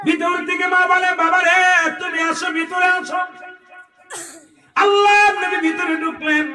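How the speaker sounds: high, chant-like sermon narration; full-force preaching. A narrator's reading. Preacher telling of the Prophet running, high declaiming voice. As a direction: declaim each phrase high, then pause.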